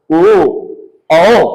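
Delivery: "ओ" from the throat with rounded lips; isolated, repeated "ओ" for pronunciation teaching; rounded "ओ" demo. Shown as a demonstration. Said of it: "गोल हो रहा है".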